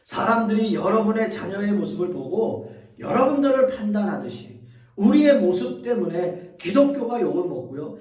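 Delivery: ordinary talk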